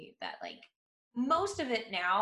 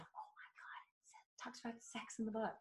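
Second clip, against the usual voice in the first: breathy scandalized whisper; hushed gossip; 14 dB quieter than usual. Retold or imitated. Gossiping kids about the book.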